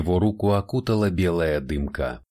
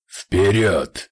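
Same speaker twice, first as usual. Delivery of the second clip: loud rumbling demon command; booming demon voice; 5 dB louder than usual. Acted. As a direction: boom it out like a demon. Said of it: "грохнул голос демона".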